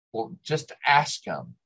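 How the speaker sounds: plain reading voice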